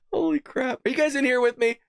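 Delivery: usual speaking voice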